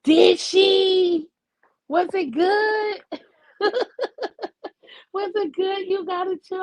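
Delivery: high-pitched delighted squeal; thrilled, laughing through it. Real, not acted. Delighted her gumbo was cooked.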